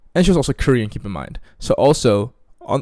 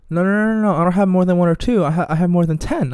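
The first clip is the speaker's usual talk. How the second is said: high, loud mock protest; put-on girl voice, pitched well up; imitating the girl; 8 semitones higher, 4 dB louder than usual. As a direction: play the girl rushing to correct him. Voicing the girl correcting him.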